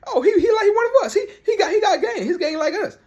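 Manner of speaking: hammed-up giddy fan; laughing mock praise. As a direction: squeal it through a laugh like a delighted fan. Mocking fans admiring Larry Bird.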